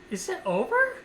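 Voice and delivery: high, small questioning voice; high-pitched retold uncertainty; timid waking question. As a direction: re-enact asking the nurse on waking. Re-enacts groggy waking question.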